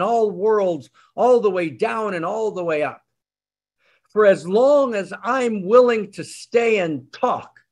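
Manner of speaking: slow and hushed with a lifted, exalted pitch; reverent awe. Acted. Butcher tells the rabbi of God, slow and reverent. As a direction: linger on each title of God in wonder.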